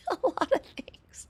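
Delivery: high, voiceless giggling words; laughing through it. Real, not acted. Reader cracking up in slime girl's line, laughing.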